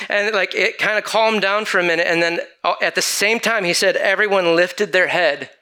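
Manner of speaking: ordinary talk, nothing marked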